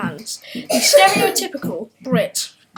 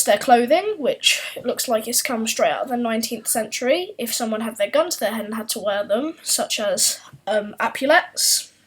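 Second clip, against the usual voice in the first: usual speaking voice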